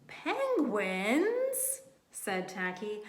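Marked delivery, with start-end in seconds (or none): 0.1-1.8 high, puzzled question; Penguins? lifted high in wonder; a bird acting baffled; surprised. A narrator's reading. Tacky puzzled at hunters.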